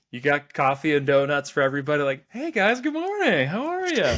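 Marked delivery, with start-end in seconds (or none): 2.3-4.2 high, singsong mock-cheerful greeting; bubbly morning greeting. Coworker bringing donuts greets the office, bubbly and high. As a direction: chirp it as an over-sunny coworker, well above your own pitch.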